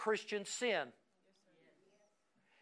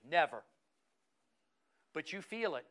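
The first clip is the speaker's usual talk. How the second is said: normal talking voice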